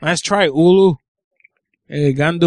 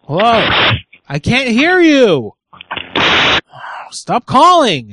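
much higher and louder, slow exasperated yell; frustrated shout; 7 semitones higher, 3 dB louder than usual. Real, not acted. Host yells at the glitching caller, frustrated. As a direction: yell it out, fed up with the bad line.

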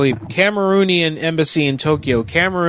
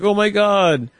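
mild mock scream, pitched up; 6 semitones higher, slower than usual. Host imitating a crappy video of people screaming, mock screaming. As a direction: imitate screamers without really yelling. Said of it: "like, people screaming".

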